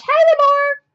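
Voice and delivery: sudden leap into a high voice; high-pitched greeting. Real, not acted. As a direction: voice shooting way up high. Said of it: "Why did my voice just get so high".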